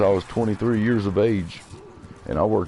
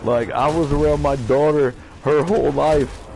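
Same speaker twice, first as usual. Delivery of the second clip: real angry rant, pitch and volume up; worked-up, emotional; 3 semitones higher than usual. Real, not acted. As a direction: vent heatedly at people who wronged you. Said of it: "Sorry for getting emotional there".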